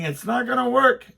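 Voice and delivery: shouted warning to son over saw. Retold or imitated.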